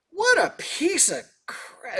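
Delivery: high, slow, drawn-out disgust; dad going off. Retold or imitated. Musician acts out dad waking at concert's end, disgusted outburst. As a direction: imitate an appalled dad's verdict. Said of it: "he's going off".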